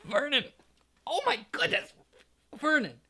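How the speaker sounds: very high, panicked cries; wife crying out to her husband in shock; choking alarm. A narrator's reading. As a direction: gasp out the name in horror. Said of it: "made a choking noise".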